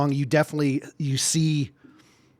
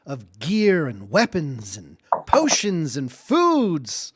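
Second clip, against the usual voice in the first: announcer voice; 4 semitones higher than usual. Acted. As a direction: call out the next stop like a conductor. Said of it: "hearing the announcement".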